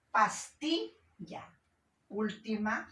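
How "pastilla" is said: "Pastilla" slowly split, high on "ti"; stressed-syllable demonstration. Shown as a demonstration. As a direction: lift and hold "ti" in "Pastilla". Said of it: "penúltima sílaba".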